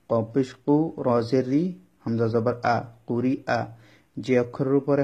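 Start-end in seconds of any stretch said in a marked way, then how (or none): none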